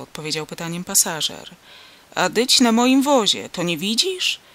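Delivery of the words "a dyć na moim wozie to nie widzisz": loud, exasperated farmer; impatient, raised-voice comeback; irritated retort. A narrator's reading. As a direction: answer as if it were obvious, pitched up. Irritated farmer answers the confused passenger.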